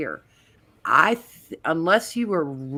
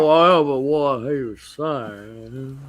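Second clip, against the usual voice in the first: very slow, slurred Mississippi drawl; Mississippi mush mouth; 4 dB louder, slower than usual. Retold or imitated. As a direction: drag it out in a mushy Mississippi accent. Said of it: "Mississippi mush mouth".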